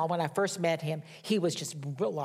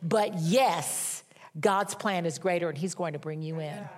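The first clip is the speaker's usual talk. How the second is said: ordinary delivery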